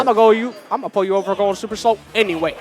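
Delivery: deliberate state trooper reasoning it out. Shown as a demonstration. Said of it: "Can you do a state trooper voice?".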